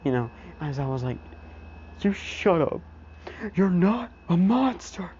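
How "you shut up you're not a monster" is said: high-pitched, forceful scolding; Aizawa insisting. Retold or imitated. Teacher tells crying student he's no monster, forceful. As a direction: cut him off with raised, emphatic pitch.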